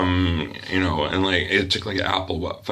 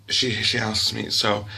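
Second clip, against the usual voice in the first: ordinary talk, nothing marked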